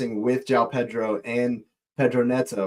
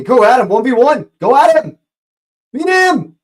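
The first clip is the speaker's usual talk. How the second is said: rising, louder shouts urging him on; excited yell; 11 semitones higher, 8 dB louder than usual. Real, not acted. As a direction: yell at the player to take him on. Urging a dribbler on, live.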